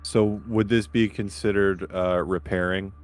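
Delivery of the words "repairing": usual speaking voice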